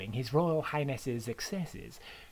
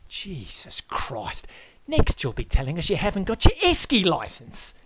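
exasperated Aussie; 5 semitones higher, 5 dB louder than usual. Acted. Host Aaron despairs of the guest, exasperated then loud. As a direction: sigh it out, then scoff.

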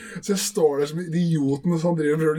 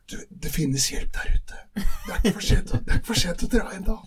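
put-on concerned old lady; 4 semitones higher than usual. Old ladies offering help.

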